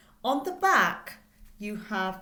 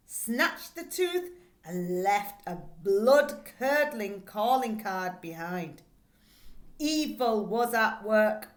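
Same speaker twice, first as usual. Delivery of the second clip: spooky story reading; 4 dB louder than usual. Acted. Teacher reads the spooky blurb to her class, dramatically. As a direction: read it like a spooky tale to kids.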